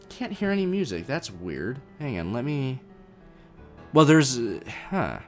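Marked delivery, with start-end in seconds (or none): none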